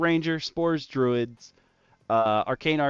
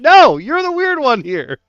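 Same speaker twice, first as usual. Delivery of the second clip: much higher and louder, mock-offended squeal; mock indignant; 13 semitones higher, 16 dB louder than usual. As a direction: fling it back, hammed-up disbelief. Mocking friends' reaction, shouted high.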